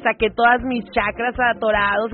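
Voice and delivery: ordinary talk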